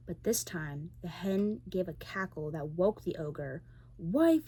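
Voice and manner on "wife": booming yell of the giant. A narrator's reading. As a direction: bellow the word to his wife. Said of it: "he yelled".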